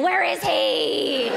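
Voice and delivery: slow, high, drawn-out wail; mock hysterical scream. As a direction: wail it like a jilted bride. Screaming in wedding dress in park.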